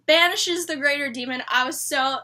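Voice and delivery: ordinary delivery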